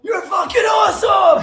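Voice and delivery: high, loud joyful shout; yelling compliments. Retold or imitated. On set, yelling compliments at a castmate, joyous shout. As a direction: yell praise at the top of your lungs. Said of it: "We're yelling at each other".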